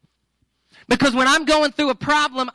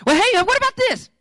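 much higher, hopeful pleading voice; eager date guy; 11 semitones higher than usual. Retold or imitated. Retelling TV blind date guy desperately pitching, high eager voice.

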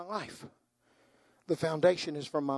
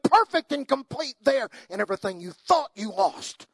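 full-force preaching; 8 semitones higher, 10 dB louder than usual. Acted. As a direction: build to a roaring crescendo about heaven. Preaching climax on heaven.

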